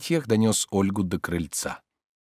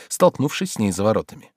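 plain reading voice

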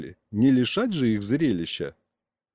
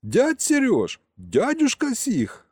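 high-pitched pleading cry to both; yelling; 11 semitones higher, slower than usual. A narrator's reading. Boy appealing to his two feuding uncles, yelling. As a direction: call each name high and drawn out. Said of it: "снова заорал я".

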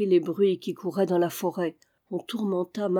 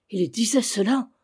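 rising surprised question, a bit louder; startled question; 3 semitones higher, 3 dB louder than usual. A narrator's reading. Jude startled by news of the murder, rising cry. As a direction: leap on the news. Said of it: "s'écria Jude".

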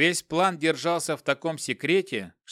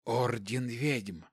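quiet, slow, emotionless hiss; 4 semitones lower, 7 dB quieter, much slower than usual. A narrator's reading. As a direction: hiss it slowly and softly. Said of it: "Шипящий голос не выражал никаких эмоций".

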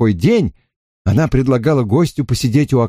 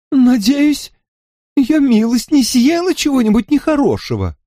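high, quick, fluttery landlady; fussy shopkeeper voice; 12 semitones higher than usual. Acted. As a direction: chatter eagerly, pitched up high. Mrs Brandy anxious question.

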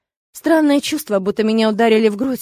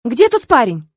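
higher, louder, pressing demand; urgent and exasperated, voice raised; impatient exclamation; 6 semitones higher, 5 dB louder than usual. A narrator's reading. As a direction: press him impatiently for an answer. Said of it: "нетерпеливо воскликнула я".

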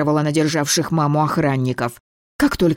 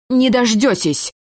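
slow, hoarse, higher and louder defiant wheeze; wheezing defiance; 8 semitones higher, 4 dB louder than usual. A narrator's reading. The girl defies the man through a hoarse wheeze. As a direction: spit it out through a wrecked throat. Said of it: "просипела".

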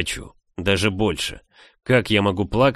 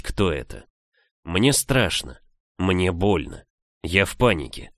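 usual speaking voice

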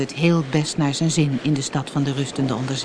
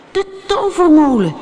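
high, loud exclamation of discovery; loud, high-pitched shout; excited cry; 10 semitones higher, 7 dB louder than usual. A narrator's reading. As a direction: call it out in sudden realisation. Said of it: "riep U K".

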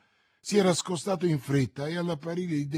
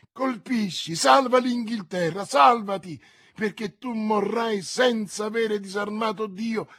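loud, higher prophetic shout; 7 semitones higher, 5 dB louder than usual. Acted. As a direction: cry it out like a vision commanding him. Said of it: "gridando alla mia anima".

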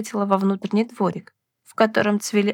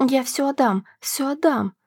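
narrator's lightly acted frightened wail; mock wail; 5 semitones higher than usual. A narrator's reading. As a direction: plead in a high, panicky voice. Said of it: "завопила черноглазая незнакомка".

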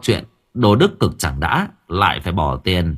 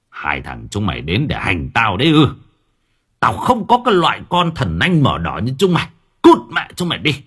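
furious father. Acted. Father scolding his two sons, rising to an angry shout. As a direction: scold them, then yell them out of the house.

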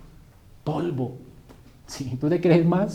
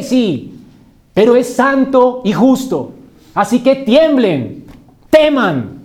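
proclamación fuerte y aguda, con palabras alargadas; prédica a plena voz; 6 semitones higher, 7 dB louder than usual. Acted. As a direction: advierte a la congregación como un heraldo del rey. (clamor de predicador, enfático y martillado)

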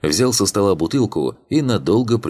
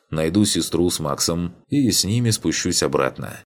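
ordinary delivery, nothing marked

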